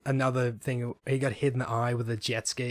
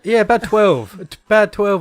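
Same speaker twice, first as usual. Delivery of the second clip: joking; 8 semitones higher, 11 dB louder than usual. Real, not acted. Joking about inches.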